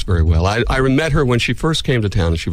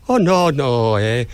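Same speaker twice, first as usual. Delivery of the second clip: mock Canadian, pitched up on the no's; Canadian accent; 2 semitones higher, much slower than usual. Host teasing about his wife's talk, mock Canadian accent. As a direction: imitate a woman with an exaggerated Canadian accent. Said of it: "she talks like she's from Canada".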